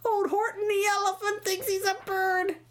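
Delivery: high-pitched mocking jeer; gleeful, jeering ridicule; taunting yell. A narrator's reading. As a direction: tease him in a squealing crowd voice. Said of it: "they yelled".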